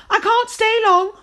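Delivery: high, loud impatient snap; sharp fairy godmother snap; snapped. A narrator's reading. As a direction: bite the words off in a hurry. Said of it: "she snapped".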